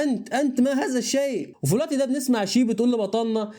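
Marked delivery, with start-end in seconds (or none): 0.0-1.5 mildly startled stammer on the repeated word; surprised, voice lifted just a little. A narrator's reading. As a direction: narrate a taken-aback question. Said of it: "بيتفاجئ جدًا وبيقول لبطلنا".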